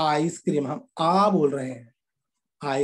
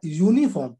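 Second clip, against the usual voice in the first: ordinary talk, nothing marked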